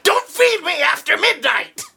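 high, shouted gremlin voice; cartoon gremlin shriek; [name]. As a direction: screech it like a little goblin. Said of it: "[name]' voice is so good".

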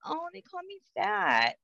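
very high, soft mock child voice; small, slow, high-pitched kid voice; hurt little kid. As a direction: mimic a wounded child, drawing it out slowly. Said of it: "they're not gonna think right away".

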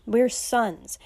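usual speaking voice

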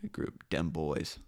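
whispered aside. Real, not acted. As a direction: say it under breath. Muttering over phone text.

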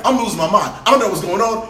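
ordinary talk

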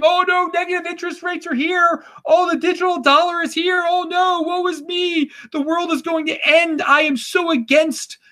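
high whiny doomsayer; hammed-up woe is me; mock whining. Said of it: "like, cry and scream".

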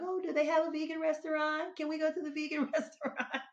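high, eager imitation of the sister, laughing at the end; imitating her sister. Retelling her late sister's vegan cravings, high eager imitation. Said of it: "she be like".

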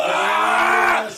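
loud hype shout of the word; shouted catchphrase. Real, not acted. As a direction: yell it like a chant.

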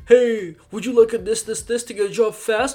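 high, loud TV pitchman; hammed-up infomercial sell. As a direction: parody a cheesy hard-sell ad. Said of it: "it sounded like an infomercial".

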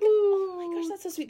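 high-pitched retold squeal of delight; gushing reply. Storyteller replaying her reply to Jin's costume invite, gushing.

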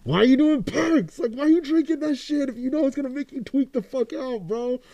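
high, exasperated questioning; baffled scolding. Acted. Scolding you guys for doing perks, high and baffled. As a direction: plead in a high, disbelieving voice. Acted baffled challenge to drug users.

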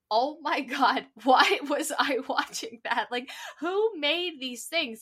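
ordinary talk

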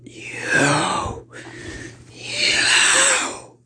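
screeching furious demon voice; wild demonic cry; angry demon. Acted. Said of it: "with a anger in its voice".

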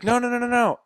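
mock-panicked protest; frantic denial. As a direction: wave it off in a hurry, high. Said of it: "he's like".

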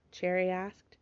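plain reading voice

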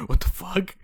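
high, loud "What the fuck?"; shocked shout. Retold or imitated. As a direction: blurt it out stunned. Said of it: "And I'm like".

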